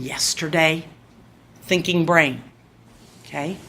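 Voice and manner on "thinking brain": ordinary talk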